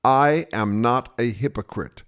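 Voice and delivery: "I am not a hypocrite" word by word; slow and clear. Acted. A-hole repeating himself to a supposedly deaf opponent, slow and clear. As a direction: spell out each word of "I am not a hypocrite" slowly. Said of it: "I'll say it again slowly and clearly".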